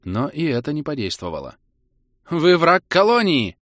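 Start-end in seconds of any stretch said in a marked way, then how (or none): 2.3-3.6 slow, loud, higher accusing shout; shouting in her face. A narrator's reading. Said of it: "выкрикнул ей в лицо Дин". (выкрик в лицо)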